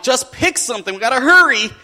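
acted exasperated rush, rising in volume; impatient dad. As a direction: hurry a dawdling child along. Hurried dad acted in story.

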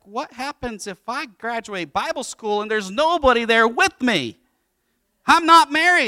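high, rising worried protest; hammed-up anxious outburst; mock panic. Son's worried objection acted out.

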